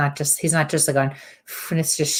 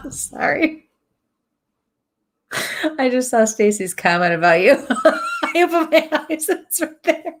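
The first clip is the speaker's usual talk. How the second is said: high, loud, talking through real laughter; laughing through words; 10 semitones higher, 4 dB louder than usual. Real, not acted. Medium apologising to the viewer while laughing, high and giggly. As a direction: keep giggling as you explain.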